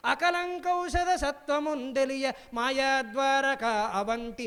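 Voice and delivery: loud, slow, full-throated verse chant; loud, slow padyam recitation; poem recitation. Acted. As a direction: belt out the padyam as Pravarudu's bitter lament. Recites lamenting padyam loudly.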